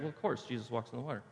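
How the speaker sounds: quick, soft, offhand throwaway; matter-of-fact. Acted. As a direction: toss it off as old news. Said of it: "It's become too expected".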